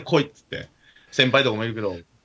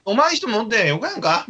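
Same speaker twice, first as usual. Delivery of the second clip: a Kagoshima dialect line, pitched higher; 9 semitones higher than usual. Retold or imitated. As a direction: imitate the old man's Kagoshima dialect. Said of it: "鹿児島弁です". (鹿児島弁で、高めにおじさんの口調をまねて)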